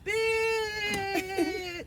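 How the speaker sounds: high, cracking laugh on the word. Real, not acted. Finishing a mock threat rant, laughing it out. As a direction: let the word break into laughter.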